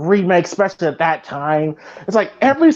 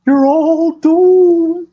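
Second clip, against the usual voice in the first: high, slow doom-prophet warning; slow ominous raspy drawl; 11 semitones higher, 5 dB louder, much slower than usual. Retold or imitated. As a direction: drag out doomed like a creepy old prophet. Quoting the film's doomsayer.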